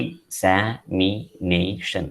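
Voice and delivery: Examination split into five slow, separate syllables; Examination in measured, spaced-out beats. Shown as a demonstration. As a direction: draw out Examination one syllable at a time. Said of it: "Five syllables are there".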